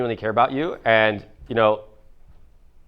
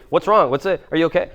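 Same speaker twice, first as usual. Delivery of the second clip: lightly higher, hurried worried-mom voice; fussing mom; 6 semitones higher than usual. Retold or imitated. Son mocking his anxious mom's hovering, raised mock worry. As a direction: mimic mom hovering with questions. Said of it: "she like".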